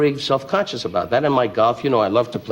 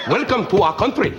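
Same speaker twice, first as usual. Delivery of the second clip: higher, put-on Indian accent, hammed up for laughs; a jokey imitation of Indian English; mock Indian accent; 8 semitones higher than usual. Comedian mocking London hotel staff in a hammed-up Indian accent. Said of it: "they talk like that 'cause they're all from India".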